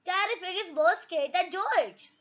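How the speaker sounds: usual speaking voice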